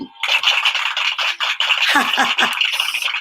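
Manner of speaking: long, breathy burst of laughter; hearty laugh. Real, not acted. Host amused by viewers' messages, long laugh. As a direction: laugh at length, really tickled.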